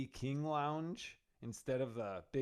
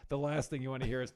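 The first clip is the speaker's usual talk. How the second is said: usual speaking voice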